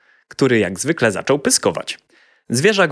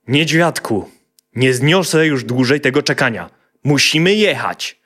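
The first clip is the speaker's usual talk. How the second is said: father calls his son, a bit higher and louder; impatient calling out; 5 semitones higher, 4 dB louder than usual. A narrator's reading. Father calling his dawdling son to leave. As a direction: hurry the boy along, raising the voice. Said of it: "zaczął nawoływać chłopca".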